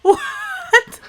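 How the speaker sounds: high, loud mock-shocked squeal; exaggerated, high-pitched imitation of disbelief; mimicked shocked what. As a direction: imitate a stunned reaction, way up high. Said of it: "you're just like".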